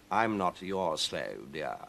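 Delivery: cat Victor. Acted. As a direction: purr it condescendingly. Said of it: "said Victor".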